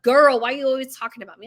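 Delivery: loud imitation of a sassy teenager; mock teenage sass; teen attitude. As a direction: complain like an embarrassed daughter. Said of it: "that's the attitude we get".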